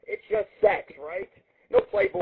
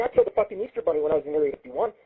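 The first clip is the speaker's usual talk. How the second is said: normal talking voice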